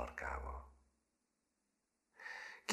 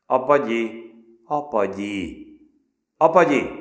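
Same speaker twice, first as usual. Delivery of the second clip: loud, high calls of the name, louder each time; calling out; 8 semitones higher, 12 dB louder than usual. Acted. Older cadets calling the new boy, loud calls. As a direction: shout for him across the ring. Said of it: "szólongatták".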